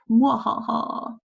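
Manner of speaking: a hammed-up scheming mwahaha; devious evil laugh. Teacher showing Lady Macbeth turning devious, mock evil laugh. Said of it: "switch to this kind of devious".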